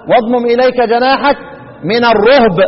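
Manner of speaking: الرهب recited as ar-ruhb; verse ending in rounded "ruhb" for الرهب; second of three readings. Shown as a demonstration. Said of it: "ثلاث قراءات".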